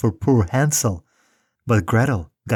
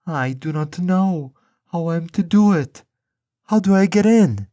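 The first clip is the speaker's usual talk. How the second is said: put-on much higher, naive little girl voice; innocent girl; 9 semitones higher than usual. A narrator's reading. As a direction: play dumb to fool the witch. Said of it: "and said".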